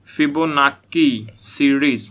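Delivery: फिबोनाकी said slowly with a hard k; fibonaki pronunciation. Shown as a demonstration. Teacher insisting on saying फिबोनाकी with a k sound. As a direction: stretch out फिबोनाकी, k not ch. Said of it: "मैं फिबोनाकी बोलता हूँ".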